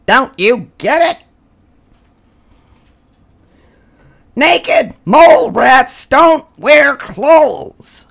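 exasperated mole rats. A narrator's reading. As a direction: spell it out to him loudly. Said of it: "others exasperated".

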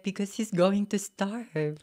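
high, loud mom's protest; Filipino mom voice. Retold or imitated. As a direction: play the worried Filipino mother. Mom's reply voiced in story.

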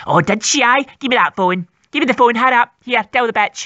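low, rough cartoon mum; gruff mum voice. Mum snatching the phone from her daughter, low gruff mum voice.

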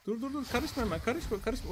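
high, hurried pleading; urgent warning. Real, not acted. Player tells creatures not to interfere, urgent warning.

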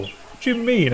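high, hurt question; slightly offended. A narrator's reading. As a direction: sound a little put out. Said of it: "slightly offended".

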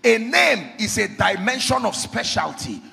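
loud, emphatic pulpit teaching; full-force preaching. Real, not acted. Preacher explaining God's name to the congregation, full-force preaching. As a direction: drive each point home loudly.

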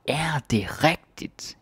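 "Er det rigtigt?" whispered, slow and rising; disbelieving whisper. Shown as a demonstration. Teacher showing whispered disbelief at news. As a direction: breathe it out in doubt. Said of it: "when we're sort of not believing".

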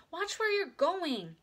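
high, drawn-out warning; crying out. A narrator's reading. Big sister warns tricycle rider, crying out. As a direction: warn loudly, stretching the words. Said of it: "cried Beezus".

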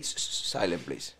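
low, very quiet put-on English; mock hushing. Sweeping lady in a joke asks for silence, mock hushing. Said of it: "la señora hacía así".

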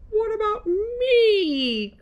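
high, drawn-out wail; crying out. A narrator's reading. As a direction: wail it slowly, way up high, stretching the last word. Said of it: "cried Carl".

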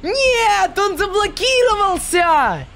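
high wailing no; despairing cry of loss; anguished cry. Real, not acted. Teammate leaves, streamer despairs.

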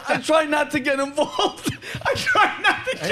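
high laughing imitation of a friend; laughing mimicry of Jimmy; mock quote laughing. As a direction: tease him while laughing.

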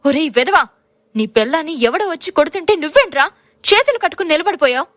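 high, loud scolding yell; furious shout. A narrator's reading. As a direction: rip into the husband for standing idle. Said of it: "అని ఆవేశంగా అరిచింది కాంచనమాల".

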